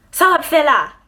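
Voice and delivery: Haitian Creole greeting in grandma's voice; grand-mère haïtienne qui appelle. Retold or imitated. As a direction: call out like a Haitian grandma down the hallway. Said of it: "captions right here for those that are not Haitian".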